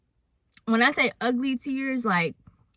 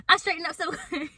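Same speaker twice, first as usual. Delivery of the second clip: laughing; 7 semitones higher, faster than usual. Real, not acted. Laughing at own reaction.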